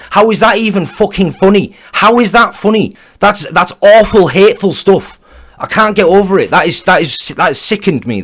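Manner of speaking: loud, high, furious disbelief; outraged yelling. Real, not acted. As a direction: shout it out, genuinely disgusted. Outraged rant at a clip.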